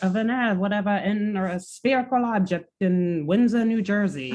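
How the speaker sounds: high, quick newsreel announcer; old-timey radio voice. Acted. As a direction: read it like a 1930s news bulletin. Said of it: "I love your radio voice".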